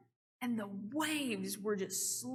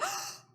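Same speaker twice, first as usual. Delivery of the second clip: sharp gasp way up high; thrilled mock gasp; delighted high gasp. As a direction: gasp like a proud friend. Friend delighted by the word.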